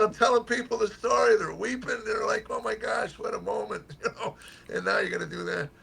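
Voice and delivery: high, hammed-up grumbling at a friend; mock complaint. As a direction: whine it like he spoiled your big moment. Retelling his mock complaint.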